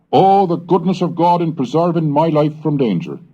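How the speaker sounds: usual speaking voice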